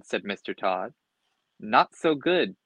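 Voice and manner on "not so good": ordinary delivery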